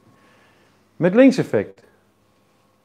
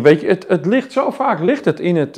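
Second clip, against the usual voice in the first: usual speaking voice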